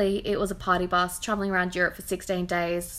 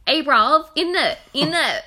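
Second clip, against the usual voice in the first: loud, higher caricature East London accent; terrible East London accent; 5 semitones higher, 8 dB louder than usual. Shown as a demonstration. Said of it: "I'm gonna do a terrible one".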